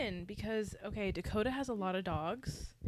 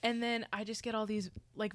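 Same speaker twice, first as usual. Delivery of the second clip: normal talking voice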